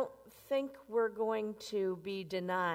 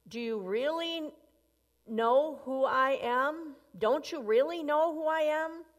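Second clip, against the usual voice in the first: pressing her harder and higher; rising indignation; 6 semitones higher, 4 dB louder than usual. Acted. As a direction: pitch climbing higher with each question. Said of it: "really angry".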